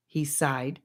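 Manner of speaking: ordinary delivery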